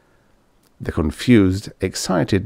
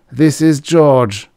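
weary man; 6 semitones higher, 5 dB louder, slower than usual. A narrator's reading.

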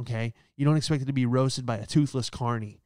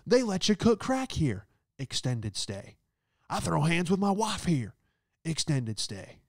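mock commercial; 5 semitones higher than usual. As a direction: sell the hotel like a trashy ad. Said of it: "in charge of the commercials".

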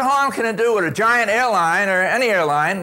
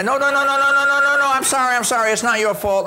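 hasty backpedal; 7 semitones higher, faster than usual. Retold or imitated.

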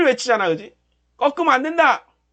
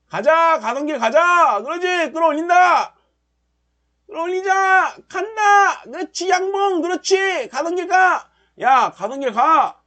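root for the candle like a fan; cheering the market on; 8 semitones higher than usual. Real, not acted. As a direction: trader yelling at his chart to climb. Cheering the chart upward live.

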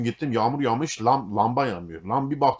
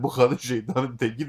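uncle's voice; 2 semitones higher than usual. Retold or imitated. Uncle voiced in retold story.

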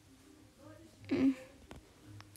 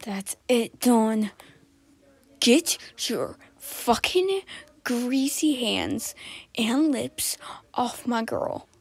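louder, slow furious warning; jealous outburst; 4 dB louder, slower than usual. Acted. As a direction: snap at Dawn to back off his girl.

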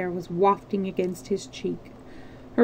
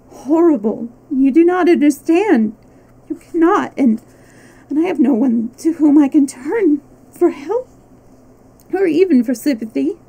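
louder and higher, near-crying outcry; anguished outcry; 6 semitones higher, 7 dB louder than usual. A narrator's reading. Wife crying out to a husband who won't understand, anguished. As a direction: hurl it out in helpless despair. Desperate outburst to her husband.

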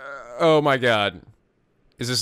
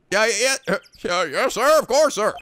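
higher, eager yes-sir babble; flustered officer; 7 semitones higher, 3 dB louder than usual. Acted. A nervous officer agreeing with his superior. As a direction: hurry to agree with the boss.

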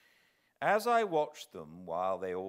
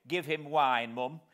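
louder, higher sharp retort; sharply; 5 semitones higher, 9 dB louder than usual. Acted. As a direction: cut in sharply. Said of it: "said sharply".

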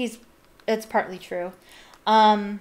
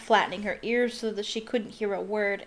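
usual speaking voice

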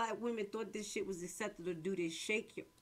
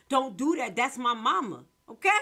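louder and higher, "mama" hit hard; real heated rant; 3 semitones higher, 9 dB louder than usual. Real, not acted. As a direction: push the point home loudly. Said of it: "I'm sorry, I'm a little loud".

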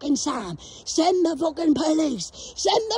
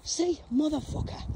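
ordinary talk

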